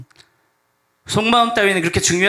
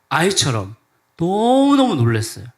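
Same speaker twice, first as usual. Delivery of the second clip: ordinary delivery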